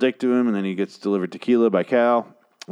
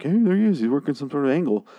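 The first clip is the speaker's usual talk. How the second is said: higher-pitched mock lookout; mock excited spotting; 6 semitones higher than usual. Snipers spotting Eugene through the scope, mock excited. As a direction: act out spotting someone, pitched up.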